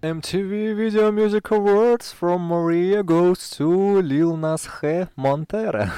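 slow, loud, high ceremonial announcement; award announcer voice. Pretend. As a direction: announce the winner like an awards host. (голос ведущего церемонии, торжественно и медленно)

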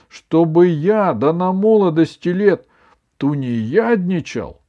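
high, loud protest; affronted grandson arguing back; indignant. A narrator's reading. Grandson refusing to loaf in his youth, indignant. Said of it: "возмутился Иван".

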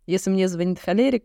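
ordinary talk, nothing marked

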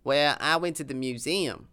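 lightly voiced Texan accent, proud of his sauce; a hint of a boastful Texas cowboy; southern accent.

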